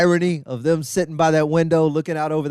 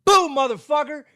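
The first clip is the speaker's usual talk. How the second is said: high, sudden mock jump-scare; pretend ghost scare; 12 semitones higher than usual. Ghost scaring a brunch customer, mock startling cry. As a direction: burst in high like a prank ghost. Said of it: "jump out and scare 'em".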